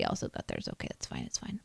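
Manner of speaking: whispered, resigned self-reassurance; whispered resignation. Real, not acted. Whispering to herself after everyone else got theirs. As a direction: let it drop to a near-silent whisper. Missed the in-game gift.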